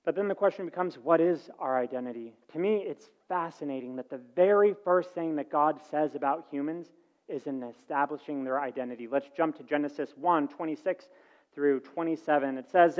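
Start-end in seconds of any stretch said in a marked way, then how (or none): none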